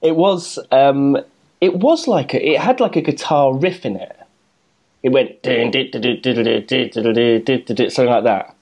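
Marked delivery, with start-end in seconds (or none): none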